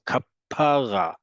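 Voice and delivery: "kapara" slowly, stressed on the middle PA, not the final ra; stress off last syllable. Shown as a demonstration. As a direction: stretch the PA in "kapara", leaving the last syllable unstressed. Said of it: "it's not gonna be on the last syllable".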